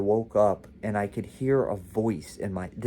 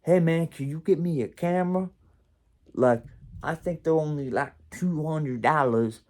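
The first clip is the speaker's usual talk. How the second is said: lightly imitated street dude asking a favour; louder, higher retold request; Scratch; 5 semitones higher, 4 dB louder than usual. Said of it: "and Scratch was like".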